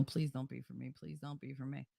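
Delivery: soft pleading under the breath; hushed repeated plea; pleading mutter. Retold or imitated. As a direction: beg quietly to yourself.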